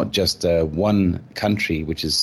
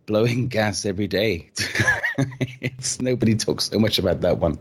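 laughing through the words; chuckling while speaking; 3 semitones higher than usual. Real, not acted. Laughs heard on lines.

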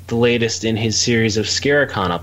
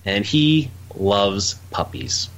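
ordinary talk, nothing marked

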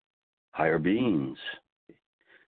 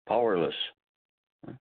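ordinary talk

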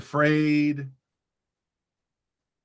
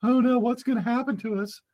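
mock fear; 5 semitones higher than usual. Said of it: "They're scared to death".

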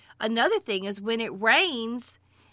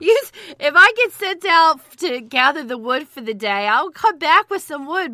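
laughing; 6 semitones higher, 9 dB louder than usual. Real, not acted. Joking about chopping off fingers.

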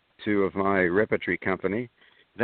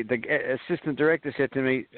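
ordinary delivery

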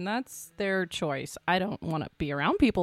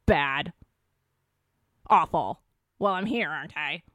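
grumpy moaning; 3 semitones lower than usual. Retold or imitated. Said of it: "those people".

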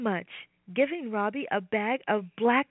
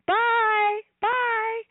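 high, loud cheerful goodbye; calling goodbye; 10 semitones higher, 5 dB louder than usual. Acted. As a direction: call out bye across the path. Waving goodbye.

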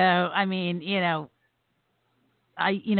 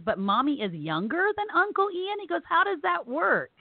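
put-on child's puzzled question; 8 semitones higher than usual.

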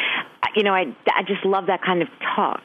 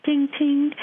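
bell chime sound; 11 semitones higher than usual. Retold or imitated. Imitating the cat food bell.

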